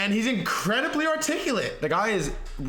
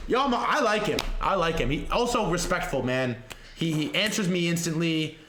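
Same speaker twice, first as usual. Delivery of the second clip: ordinary delivery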